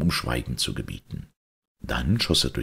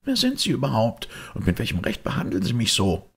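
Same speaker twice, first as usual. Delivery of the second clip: indignant maid, pitched up at first; 9 semitones higher, faster than usual. Acted. Maid challenges the man who dragged her in, indignant. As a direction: demand an explanation, affronted.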